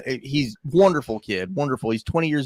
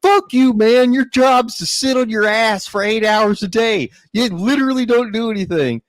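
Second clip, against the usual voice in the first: furious retort; 6 semitones higher than usual. Retold or imitated. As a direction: yell it back at the boss, high and hot. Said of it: "And he's like".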